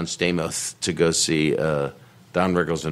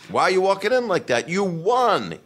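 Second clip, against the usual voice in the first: high-pitched mock heckle; imitating Don Rickles; 12 semitones higher than usual. Retelling a comic needling a restaurant stranger, high and exasperated. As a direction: call it out high, as a hammed-up insult comic. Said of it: "And he goes".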